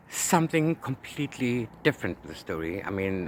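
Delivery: ordinary talk, nothing marked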